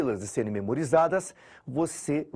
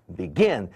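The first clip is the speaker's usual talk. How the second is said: "begin" stressed on GIN, louder second half; be-GIN, first syllable light; stress on last syllable; 5 semitones lower, 3 dB louder than usual. Shown as a demonstration. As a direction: punch the final syllable of begin. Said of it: "Sílaba tônica é a última".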